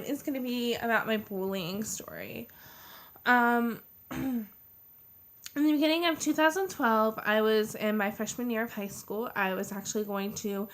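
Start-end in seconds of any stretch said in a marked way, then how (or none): none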